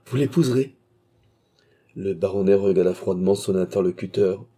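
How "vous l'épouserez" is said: higher, louder eager cry; wild outburst. A narrator's reading. Clerk eagerly grants the baronet his daughter, wild outburst. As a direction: blurt it out with savage eagerness. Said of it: "avec un sauvage emportement".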